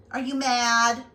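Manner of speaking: ordinary talk, nothing marked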